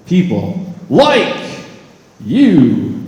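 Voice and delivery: slow "People like you" rising on like; stress on LIKE. Shown as a demonstration. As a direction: push up on "like". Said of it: "I put emphasis on different words".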